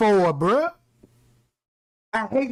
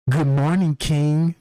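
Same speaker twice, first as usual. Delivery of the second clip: mock wake-up voice; 7 semitones lower, slower than usual.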